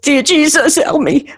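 high-pitched, desperate cry for help; screamed plea. Acted. Driver in panic calls out to Jesus, screamed plea. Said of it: "I scream".

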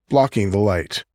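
ordinary delivery, nothing marked

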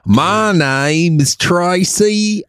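high, drawn-out, hammed-up country twang; as country as it gets; country accent. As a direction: exaggerate a slow country drawl on every vowel. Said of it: "that's as country as it gets".

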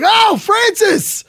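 high, loud, drawn-out delighted cry; gushing greeting. Retold or imitated. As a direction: call out to him, stretching the name. Acts greeting Francis on seeing him.

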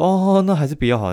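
high mock voice of a client backing off; mimicking the client. Mocking the client who backs out, high-pitched imitation. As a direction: squeak it out like the cheapskate client caving.

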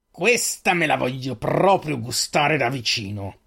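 a slow growled gloat over his cornered prey; growling. A narrator's reading. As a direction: savour each word like a threat. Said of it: "disse ringhiando".

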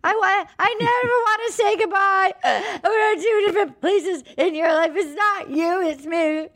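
very high hammed-up crying; Gabby sobbing. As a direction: wail it as a mock tearful breakup speech. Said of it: "she goes to him and she's sobbing".

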